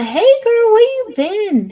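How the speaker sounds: high, loud, thrilled hello to a friend; excited greeting. Real, not acted. Excited greeting to arriving viewer.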